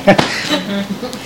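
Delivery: breathy laugh at own tears; soft embarrassed laugh. Real, not acted. Preacher laughs after admitting tears, softly. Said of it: "And I'm crying".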